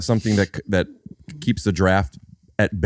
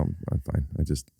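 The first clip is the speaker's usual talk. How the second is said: quiet; 5 semitones lower, 10 dB quieter than usual. Retold or imitated. Retelling his reply on set.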